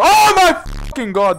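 sudden loud high yelp of shock; startled outburst. Real, not acted. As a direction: blurt it out in a jump-scare shriek. Startled by Granny in game.

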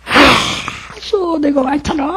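put-on high, breathy gasp of realization, then a high old-woman voice; an old woman suddenly understanding, voiced high; awed old woman. Grandmother realizes her mistake, high awed voice. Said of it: "言い出した".

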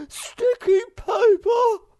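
very high, loud, slow screech. Acted. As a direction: shriek it in horror. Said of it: "screeched the Hemulan".

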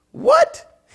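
loud, very high-pitched "what?"; retold bewildered dad. Pastor imitating his dad not understanding him, comically bewildered. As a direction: squawk it in mock total bafflement. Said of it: "And he goes".

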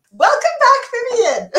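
high, loud cheery greeting; excited welcome. Real, not acted. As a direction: greet her back with a big squeal. Cheering co-host back on air.

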